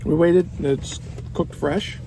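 chewing while talking; mouth full of burger. Real, not acted. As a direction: between bites of food. Seen 0: bit burger at -1, holds it at mouth while talking.

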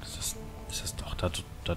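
soft unvoiced whisper. Real, not acted. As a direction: whisper it to yourself. Peering at something far off.

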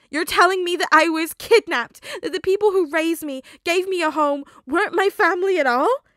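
high, very loud disbelieving shout; outraged shouting. Acted. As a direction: yell it at the agent. Peter outraged at the news.

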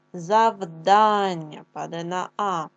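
завдання drawn out slowly, the А held long and falling; stress on А in завдання. Shown as a demonstration. Teacher to class, slowly stressing завдання on А. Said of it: "Проговоримо".

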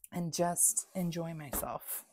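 ordinary talk, nothing marked

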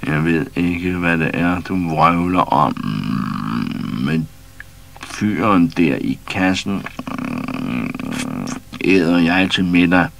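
drawn-out, slow, purring threat; slow menacing lion. A narrator's reading. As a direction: savour every word like a predator. Lion voice in story.

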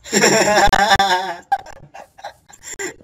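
high open laugh; loud burst of laughter. Real, not acted. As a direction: laugh out hard at the joke. Laughs at friend's joke.

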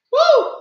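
high excited woo whoop; delighted high woo over the food. Real, not acted. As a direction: let out a joyful high whoop. Exclaims over mama's cooking.

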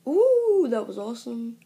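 excited. Real, not acted. Gamer to viewers after a great move: thrilled squeal. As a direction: squeal with delight at the lucky play. Amazed at a game moment.

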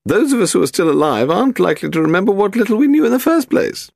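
put-on high, haughty elderly lady; very old woman. A narrator's reading. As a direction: play a grand, frail old aristocrat. Lady Delamore talking.

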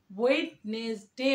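slow "wed-nes-day", each syllable apart; wrong pronunciation. Shown as a demonstration. Teacher showing what we won't say, slow spelled-out pronunciation. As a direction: say ওয়েডনেসডে slowly with the d sounded. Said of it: "বলবো না".

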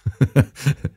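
high, bright burst of laughter. Acted. Holmes amused by the intruder's insults, laughing brightly. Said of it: "Jetzt lachte Holmes hell auf".

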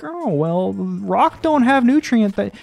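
high, slow mock nitpicker; mocking pedant. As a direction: drag out the pedant's whine.

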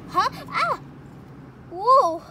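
sudden high startled cry; startled scream. Pretend. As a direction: jump with fright. Doll startled by a "Boo.

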